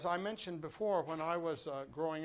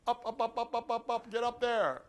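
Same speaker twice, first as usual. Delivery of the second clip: urgent; 5 semitones higher, 5 dB louder than usual. Real, not acted. Coaching them higher.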